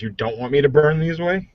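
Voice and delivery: ordinary delivery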